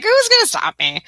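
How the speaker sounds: high, loud cheeky challenge; defiant quote. Retold or imitated. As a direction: throw down a playful dare. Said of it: "It's like".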